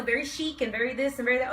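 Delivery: ordinary talk, nothing marked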